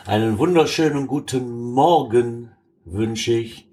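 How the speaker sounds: lazy, lingering good morning; slow drawn-out greeting. Real, not acted. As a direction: stretch the greeting out slowly. (langsam gedehnte Begrüßung)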